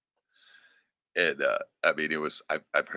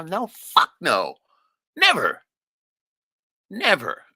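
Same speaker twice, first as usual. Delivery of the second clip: louder, higher, slow insistent no; emphatic denial; 11 semitones higher, 8 dB louder, much slower than usual. Real, not acted. Insisting he'd never disrespect his grandmother, emphatic. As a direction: hammer home that he never would.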